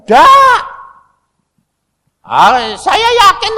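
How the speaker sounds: high shouted no; loud high-pitched outburst; emphatic denial. Retold or imitated. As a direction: cry it out in a sharp high burst.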